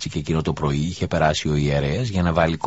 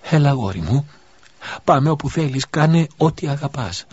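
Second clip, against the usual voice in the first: higher, tearful-happy mother; joyful relief; 8 semitones higher than usual. Acted. Mother answers her revived boy, overjoyed and tearful. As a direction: let the joy lift each phrase higher.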